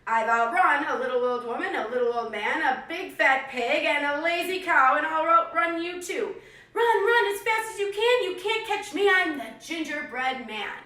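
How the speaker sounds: loud, rising cheeky boast; taunting gingerbread man. Acted. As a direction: laugh and tease the horse. Cookie taunts the horse.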